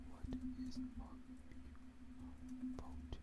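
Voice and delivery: breathy whisper while typing the search; whispered to self. Real, not acted. Typing a Google search for viewers, whispering to herself. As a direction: murmur it under your breath as you type.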